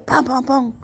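voiced gunfire imitation; imitated gunshots. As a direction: mimic rapid shots with the voice. Imitates the shots that killed him.

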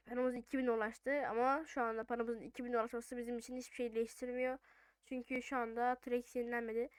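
ordinary delivery

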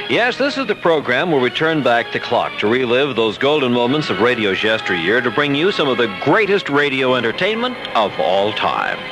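ordinary talk, nothing marked

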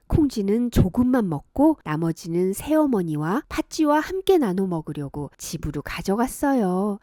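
plain reading voice

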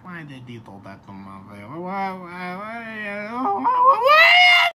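low start climbing to a loud wail; hammy escalating cry of why; hammed-up wailing parent. Acted parent wailing over baby.

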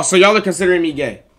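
ordinary talk, nothing marked

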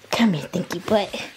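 ordinary talk, nothing marked

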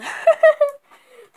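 loud high-pitched laugh; high burst of laughter. Real, not acted. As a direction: crack up at yourself. Laughs at dropping them.

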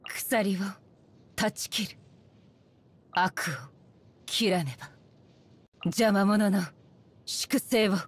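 low, solemn warrior's pronouncement; short phrases intoned low and measured; grim battle resolve. Acted. Warrior vowing to cut down evil, grim and low.

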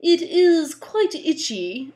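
slow, high, very proper voice; prim rascal. Acted. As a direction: answer politely, nothing like a pirate. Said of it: "answered the man".

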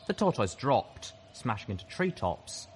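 ordinary delivery, nothing marked

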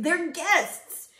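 high, loud mock wail; sobbing. A narrator's reading. Moose excuses his guests, sobbing. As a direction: wail helplessly, hammed up. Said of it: "sobbed poor Thidwick".

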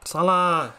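loud high cheer of a name; excited shout. Real, not acted. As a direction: yell it like a jackpot hit. Cheering a big pack pull.

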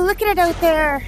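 ordinary talk, nothing marked

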